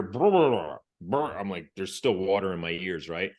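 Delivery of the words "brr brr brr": mouthed brr brr brr, loud and high like a whistle; whistle blasts. Retold or imitated. Instructor's whistle heard underwater, loud mouthed brr. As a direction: imitate a sharp whistle with your lips. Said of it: "I hear".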